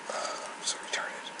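soft slow whispered aside; real whispered scolding; whispered self-scold. Real, not acted. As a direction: whisper it at yourself. Self-critical aside after sigh.

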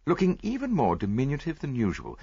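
ordinary delivery, nothing marked

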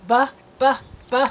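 short, separate "buh" sounds for B; letter sound. Shown as a demonstration. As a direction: say the sound of b three times, punchy. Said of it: "I wanna hear you say it".

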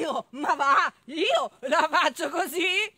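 loud neighing horse voice; whinny the indignant denial. Acted. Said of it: "Incontrò un cavallo".

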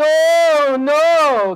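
long, swooping moan; moaning bear. A narrator's reading. Bear crashing through the bushes, moaning. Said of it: "and he was moaning".

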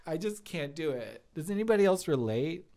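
ordinary talk

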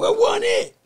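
sudden loud, high exclamation; emphatic outburst. Real, not acted. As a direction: blurt it out in disbelief.

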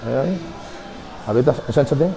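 quiet coaching. Real, not acted. Posing under the light, soft.

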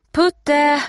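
high, loud, sustained call. A narrator's reading. Steffi searching for Putte, calling out. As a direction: call a lost dog from far away. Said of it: "ropar hon igen".

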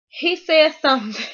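usual speaking voice

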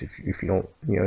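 ordinary talk

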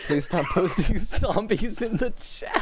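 loud, slow, laughing plea; laughing shout. Real, not acted. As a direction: beg through laughter.

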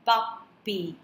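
"puppy" split as pup, py; syllable by syllable. Shown as a demonstration. Teacher dividing the word, "puppy" in two beats. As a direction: pause between the halves of "puppy". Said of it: "We are again dividing the word".

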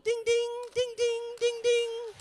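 high, loud bell imitation; piercing imitated ding-ding; bicycle bell. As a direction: ring out like a bike bell, very high. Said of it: "on a bicycle and going".

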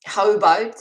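high, loud Canadian "How about?"; a hammed-up Canadian accent guess; Canadian accent attempt. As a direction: try out a Canadian "aboot". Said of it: "Go for a Canadian accent instead".

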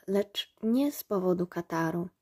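ordinary delivery, nothing marked

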